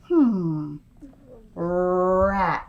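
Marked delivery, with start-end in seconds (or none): 1.5-2.6 "Rat" with a long, held R; slow word. Shown as a demonstration. Teacher modelling for children, "Rat" said slowly with R held. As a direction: draw out the R at the front of "Rat". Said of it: "I'm gonna say it slowly".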